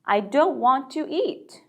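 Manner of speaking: slow, "don't" stressed; slow model sentence. Shown as a demonstration. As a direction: slowly show the negative. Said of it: "don't만 앞에 붙여주시면". ("don't"를 살려 천천히)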